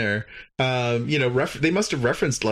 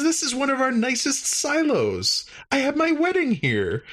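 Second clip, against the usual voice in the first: higher, eager voice of a cheerful host; mock alien host; 12 semitones higher than usual. Recapping the episode, voicing the host correcting the crew, higher and eager. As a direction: play the happy alien host, lightly.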